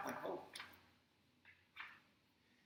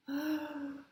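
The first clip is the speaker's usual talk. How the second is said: gasp; 6 semitones higher than usual. Acted. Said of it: "very last bit of breath".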